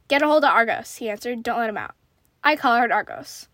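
lively, deliberate read-aloud of a tense tale; story narration. A narrator's reading. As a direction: read the story out with animated dialogue.

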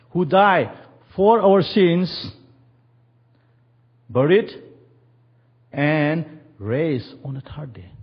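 slow, high, loud declaration; slow ringing proclamation; proclaiming scripture. Acted. Preacher quoting scripture with force.

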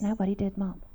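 low, very soft retold reply; meek child's answer. Son admitting to mom that nobody taught him, meekly. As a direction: answer small and subdued.

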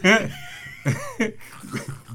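big high-pitched laugh. Real, not acted. Laughing at partner being roasted.

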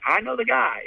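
high-pitched eager realization; eager volunteering. Retold or imitated. Teller answers man looking to hire a cleaner, eager. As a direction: pitch it up, keen to offer someone. Has perfect candidate for job offer.

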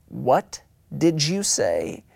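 very slow, ominously quiet question; stern parent. Acted. Mom and dad to kid who said a bad word, slow and stern. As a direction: drag out each word as a warning. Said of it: "deathly still and quiet as mom and dad did that slow motion turn".

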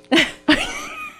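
high, real burst of giggling; tickled laugh. Real, not acted. Laughing at herself over the spilled supper, a real giggle. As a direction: let the laugh break out of you. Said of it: "I started getting tickled".

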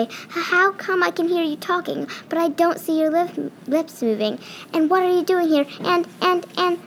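a frightened little mouse stammering questions; nervous stammer. Acted. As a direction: stumble over the words in amazement. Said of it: "Then very quietly he managed to say".

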